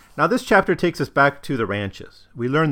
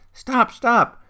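put-on high, snapped outburst in German-accented English; exasperated mother crying out at her child; 10 semitones higher than usual. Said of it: "cried out".

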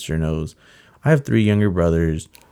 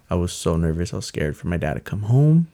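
usual speaking voice

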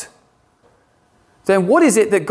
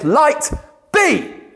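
"Light" and "be" much higher, rising and commanding; forceful command; 10 semitones higher than usual. Shown as a demonstration. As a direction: speak "Light be" as a forceful activation. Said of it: "It's a forceful activation".

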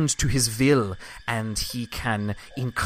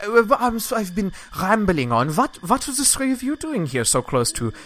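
higher, lightly put-on voice of a chatty woman; NPC woman voice; 8 semitones higher than usual. GM voicing the rambling woman to the party, higher voice. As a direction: play her as flustered and apologetic.

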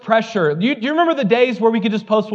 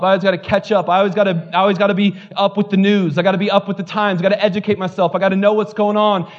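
ordinary talk

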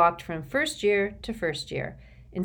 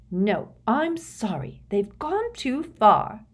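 indignant; 5 semitones higher, 3 dB louder, slower than usual. A narrator's reading. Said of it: "looking positively furious".

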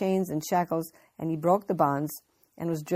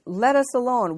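raised, loud demon's cry; 7 semitones higher, 7 dB louder than usual. A narrator's reading. Demon cries out in scripture.

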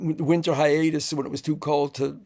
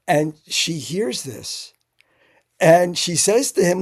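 ordinary talk, nothing marked

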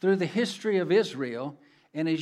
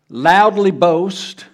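"loudly boast" drawn out slow and much louder; stressed loudly boast; 12 dB louder, much slower than usual. Shown as a demonstration. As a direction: lean hard on "loudly boast", slowly. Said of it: "It means to".

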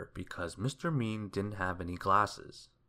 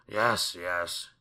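hasty, anxious agreement; panicked; 2 semitones lower, 4 dB louder than usual. A narrator's reading. Mister Mean giving in to the washerwoman, panicked. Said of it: "he cried in panic".

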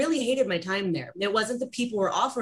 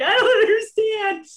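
high laughing bewilderment; confused laugh; 12 semitones higher, 7 dB louder than usual. Retold or imitated. Student floored by the teacher, laughing high in confusion. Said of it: "I'm like".

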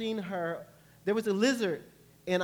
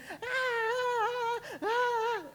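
high mock wail of panic; hammed-up fearful crying; panicked wailing; much slower than usual. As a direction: cry out like someone running blindly in fear. Acting out panicked running.

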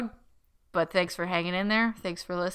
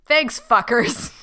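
laughing; 5 semitones higher, 8 dB louder, slower than usual. Retold or imitated. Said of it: "reading sign-off, laughing".

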